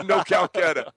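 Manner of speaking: laughing. Real, not acted. Co-host joking about today's Calcutta, laughing. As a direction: repeat it through laughter.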